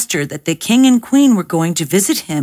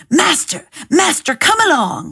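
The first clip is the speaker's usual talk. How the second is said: higher, urgent calling out; excited cry; 7 semitones higher than usual. A narrator's reading. Cat calls his master in excitement, higher and urgent. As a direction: call out to your master in a hurry. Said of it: "he cried".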